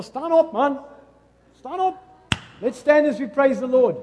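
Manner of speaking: loud, high call to stand up; shouting. Real, not acted. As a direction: rouse the congregation to its feet. Pastor shouting at congregation to stand.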